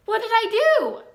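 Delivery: put-on a young pig who has no idea what went wrong; puzzled piglet. A narrator's reading. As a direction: Wilbur asks Charlotte after falling, high and bewildered. Wilbur after his fall.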